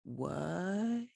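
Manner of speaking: soft, drawn-out rising disbelief; hushed stunned crowd reaction; agog. Acted. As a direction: let the word stretch upward, dumbfounded.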